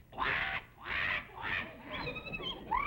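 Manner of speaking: high squawking quack, quack, quack; duck quack imitation. Leader imitating a duck for the rangers to identify. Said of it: "I will imitate a bird".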